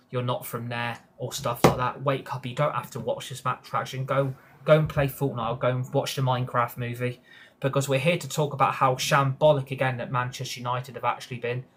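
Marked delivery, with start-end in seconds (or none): none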